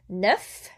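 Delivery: NEUF ending in a clear F sound; final F pronounced. Shown as a demonstration. Teacher showing the F is heard, final F pronounced. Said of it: "there's the F".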